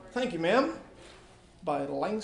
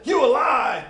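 loud, high accusing shout; furious shout at the boy; 8 semitones higher, 14 dB louder, slower than usual. Acted. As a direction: call him a liar to his face. Woman calls out boy's lie.

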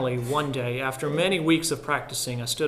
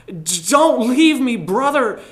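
much higher, louder, slow pleading cry; frightened cry; 9 semitones higher, 10 dB louder, slower than usual. A narrator's reading. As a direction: beg in terror not to be left. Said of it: "he cried".

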